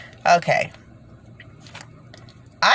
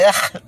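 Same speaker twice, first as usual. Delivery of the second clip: a short groan of disgust, a bit higher and louder; 5 semitones higher, 4 dB louder than usual. Real, not acted. Naming what she feels, a disgusted groan. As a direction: push out a groan of revulsion. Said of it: "It's like a".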